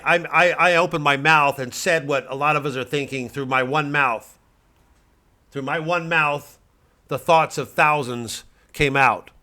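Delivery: loud, high, ranting right-wing pundit; blustering pundit. Acted. Satirical pundit ranting to his audience, blustering loud. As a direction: bluster like a cable news blowhard.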